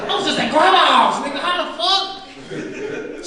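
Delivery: high, bewildered voice, dragged out; baffled at waking somewhere else; a high, slow stunned question; confused dream shock. Retold or imitated. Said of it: "You like".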